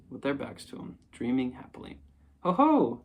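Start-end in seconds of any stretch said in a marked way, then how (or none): 2.4-3.0 loud, high, sudden exclamation. A narrator's reading. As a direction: burst out to startle them. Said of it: "said Christopher Robin loudly and suddenly".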